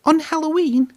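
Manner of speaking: high, anxious Percy asking; frightened little engine; scared question. A narrator's reading. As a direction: ask it with worry rising. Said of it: "asked Percy, feeling scared".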